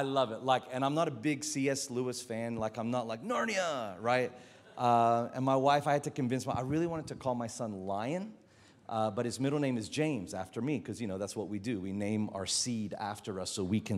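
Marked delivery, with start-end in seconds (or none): none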